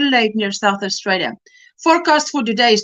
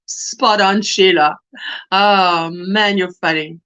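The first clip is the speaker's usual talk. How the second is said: usual speaking voice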